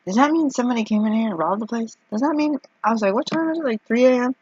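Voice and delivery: mock nervous. Nervous thoughts in restaurant.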